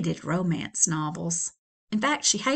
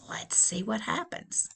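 soft hushed whisper; whispered aside; 7 dB quieter than usual. Real, not acted. Reader teasing the story start, hushed whisper. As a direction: lean in and whisper.

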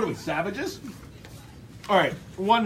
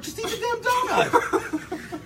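mock exasperated; slower than usual. Pretend exasperation about donut expertise.

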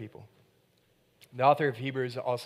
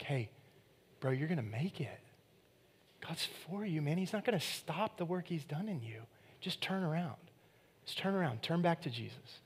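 gentle encouragement; 3 semitones higher, 6 dB quieter than usual. Retold or imitated. Gentle encouraging brother quoted.

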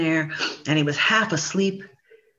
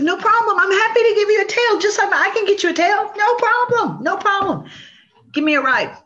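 high, loud, eager salesman; eager overpromising; 12 semitones higher, 3 dB louder than usual. Acted. Possum eagerly promising the turtle a tail, high and loud. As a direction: gush promises to seal the deal.

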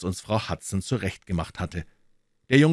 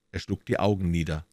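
ordinary delivery

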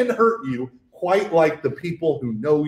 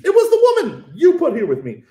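mock Adam; 9 semitones higher than usual. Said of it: "mocking Adam's blame".

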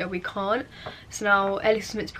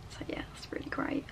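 whispered dry sarcasm, annoyed; sarcastic whisper; 16 dB quieter than usual. Real, not acted. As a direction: whisper it through real annoyance. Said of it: "I'm genuinely fuming".

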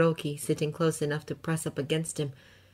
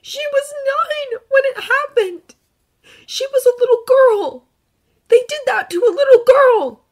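shaky voice; 13 dB louder than usual. Acted. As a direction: voice trembling, close to tears. Izuku, upset.

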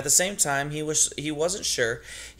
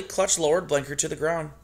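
usual speaking voice

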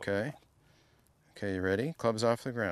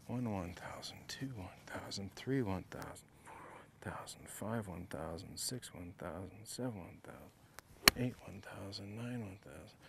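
whispered count; 2 semitones lower, 10 dB quieter than usual. Shown as a demonstration. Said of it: "he told me I gotta hit this ball quick".

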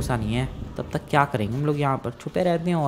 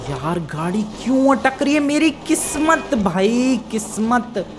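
exasperated lament; 6 semitones higher than usual. Acted. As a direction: stretch it out in high-pitched despair. Car stuck as portal may close.

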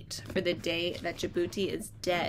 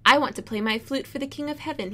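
higher, louder eager tortoise; hopeful tortoise voice; 5 semitones higher, 5 dB louder than usual. Acted. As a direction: plead it up brightly as the tortoise. Tortoise's line voiced in story.

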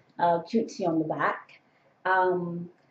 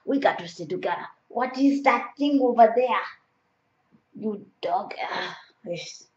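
put-on African accent. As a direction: talk to yourself in an imitated African accent. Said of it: "doing an African accent".

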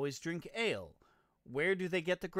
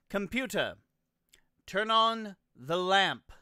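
voice command; 5 semitones higher, 12 dB louder than usual. Real, not acted. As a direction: spell it out loudly for the voice assistant. Repeating a voice command to a computer.

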